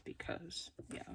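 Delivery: soft, breathy whispered aside. Real, not acted. Whispered aside while gluing.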